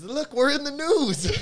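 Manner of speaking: a coworker showing off to colleagues, high and giddy; excited coworker. Retold or imitated. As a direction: squeal it like a proud office worker. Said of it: "he showed all his coworkers".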